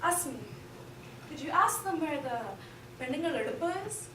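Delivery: softly imitating mom's hushed request; mother's whisper. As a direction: quietly ask so nobody hears. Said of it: "she'd lean over and whisper".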